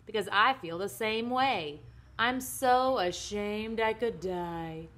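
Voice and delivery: loud, drawn-out daughter's wail; hammed-up mortified child; melodramatic. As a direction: wring every word for drama. Daughter dramatically declares her shame.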